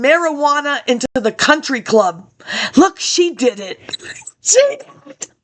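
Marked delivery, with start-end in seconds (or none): 4.4-5.3 much higher, squeaky echo of the line. Acted. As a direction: say "She did it" again up high, mocking.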